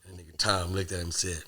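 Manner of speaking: normal talking voice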